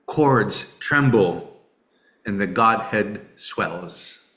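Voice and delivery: slow, measured poetry recital; poem reading. Real, not acted. Reading a late-life poem by the father to friends, poem reading. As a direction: read it phrase by phrase, with long pauses.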